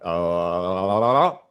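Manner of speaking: long swelling "oh", ending loud; struck with recognition. Retold or imitated. Speaker hearing her line about darkness, acted recognition. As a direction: draw it out as the realization grows. Acts out recognizing himself in quote.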